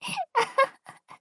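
high, loud burst of laughter; delighted teasing laugh; amused laugh. Acted. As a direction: laugh out at him.

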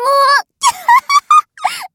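very high, loud falling cackle; mocking cackle. Mistress cackling at her disgusting pet, very high and loud. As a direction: cackle with contempt.

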